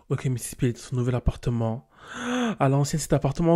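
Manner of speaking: ordinary delivery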